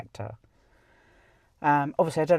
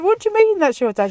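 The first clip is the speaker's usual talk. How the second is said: higher and louder, fast and incredulous; astonished question; 13 semitones higher, 7 dB louder, faster than usual. Retold or imitated. Asks the friend in astonishment, fast, high and loud. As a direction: blurt it out in disbelief. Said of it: "And I said".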